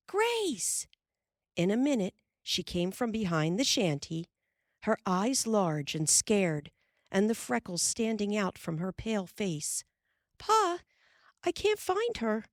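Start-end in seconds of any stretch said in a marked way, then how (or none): 0.1-0.9 high carrying call. A narrator's reading. Carrie runs calling for her sister, high call. 10.4-12.5 high frightened voice; a child afraid, higher; scared child. Acted. Frightened girl tells Pa she can't find Grace, high and scared.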